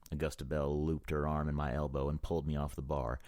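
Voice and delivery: ordinary delivery, nothing marked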